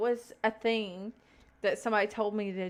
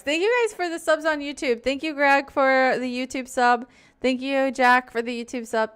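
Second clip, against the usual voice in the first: excited thanks; 3 semitones higher, 7 dB louder than usual. Real, not acted. Thanking subscribers on stream.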